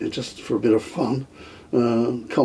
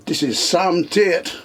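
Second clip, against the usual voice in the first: a rough stab at a Barbadian radio DJ accent, higher; 5 semitones higher than usual. Retelling the DJ heard on the car radio, mock Barbadian accent. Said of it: "Can't do the accent".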